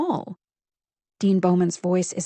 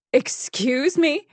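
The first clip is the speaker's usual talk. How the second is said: offended excuse me; 6 semitones higher, 3 dB louder, much slower than usual. A narrator's reading. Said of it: "she starts".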